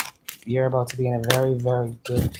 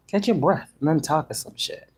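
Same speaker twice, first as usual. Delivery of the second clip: usual speaking voice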